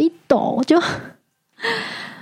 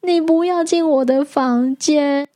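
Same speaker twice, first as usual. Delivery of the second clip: much higher, pleading with a gecko; anxious pleading; 9 semitones higher than usual. Retold or imitated. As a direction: coax it away in a high anxious voice. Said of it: "我就跟他说".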